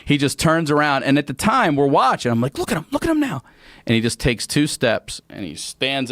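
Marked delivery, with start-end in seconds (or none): 2.6-3.4 high, hushed-comic urgency; high mock-alarmed aside; retold urgent pointing-out. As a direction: get the others to look, pitched way up. Urging others to watch cousin, retold.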